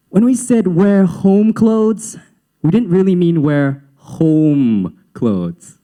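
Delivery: imitated teacher, very drawn-out words; slow, careful explaining. Teacher correcting a pupil's outfit, slow and pointed. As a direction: spell it out slowly for a clueless pupil.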